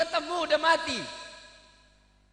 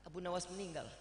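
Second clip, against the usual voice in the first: low, soft feigned mourning; mock grief; 6 semitones lower, 12 dB quieter, faster than usual. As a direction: whisper out the sad news. Wife fakes grief. (duka pura-pura dengan pelan)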